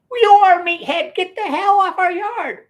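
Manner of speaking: high, loud, slow scolding shout; angry yelling sister. Retold or imitated. Sister ordering the boys out of the yard, a high angry shout. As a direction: chase them off the yard. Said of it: "that's what she said".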